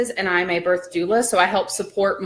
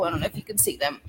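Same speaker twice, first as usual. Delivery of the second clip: normal talking voice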